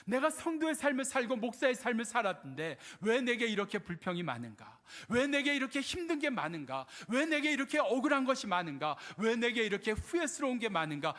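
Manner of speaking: high, driving run of self-questioning; impassioned preaching. Retold or imitated. Preacher replaying his questions about his own life, impassioned preaching. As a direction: hammer out each repeated question.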